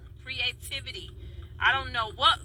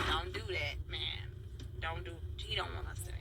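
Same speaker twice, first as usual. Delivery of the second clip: hushed plea; 6 semitones lower, 11 dB quieter than usual. Retold or imitated. Telling the lady to stop filming.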